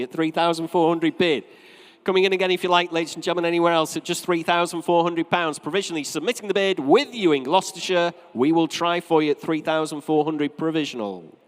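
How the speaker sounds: projected, rolling saleroom call; auctioneer's patter. Real, not acted. Auctioneer to last bidders, submitting a provisional bid, rolling patter. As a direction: invite a last bid, then close it provisionally.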